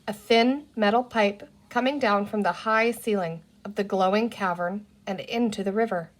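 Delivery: ordinary delivery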